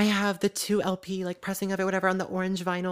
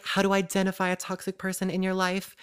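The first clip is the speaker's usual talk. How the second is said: ordinary talk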